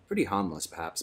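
ordinary delivery, nothing marked

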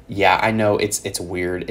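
ordinary talk

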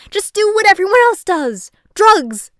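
loud, high-pitched rant; exasperated shout. Acted. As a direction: yell it as the obvious solution. Ranting about angry little man.

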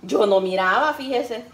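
ordinary talk

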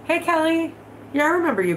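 ordinary talk, nothing marked

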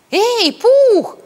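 high, loud call to a friend; piercing Piglet-style hail; excited shout. A narrator's reading. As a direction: holler out in a high voice. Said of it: "закричал Пятачок".

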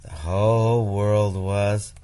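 ordinary delivery

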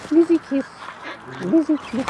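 high coaxing call. Real, not acted. Asking bird for kiss.

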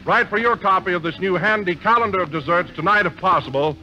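ordinary delivery

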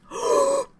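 high, sudden gasp; excited gasp. Real, not acted. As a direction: gasp in high-pitched anticipation. Waiting for premiere to start.

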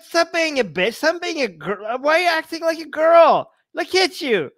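high-pitched, hammed-up tirade; mock berating. Krishna shaming crying Arjun, loud mock scolding. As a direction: rant at him in exaggerated outrage. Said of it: "This is what Krishna is saying".

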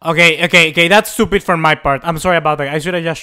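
loud, rueful self-blame; loud self-reproach; loud apology. Real, not acted. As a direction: own the mistake out loud. Admits own mistake loudly.

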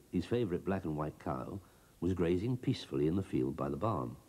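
ordinary delivery, nothing marked

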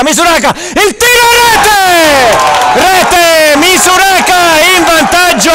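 breathless italian goal commentary. Real, not acted. Goal call shouting.

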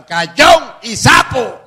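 high, loud, slow taunt; loud mocking street yell; shouted insult. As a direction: drag out the jeer at full volume. Kids shouting insult again.

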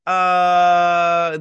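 loud, high drawn-out uh; an exasperated uh like rolling your eyes; annoyed uh. Retold or imitated. Vlogger reacting to the annoying villain, loud exasperated uh.

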